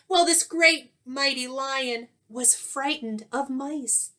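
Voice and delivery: lively storybook read-aloud with rhyme; reading to children. A narrator's reading. Teacher reading a rhyming story to young pupils, animated. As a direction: read it like a picture book to the class.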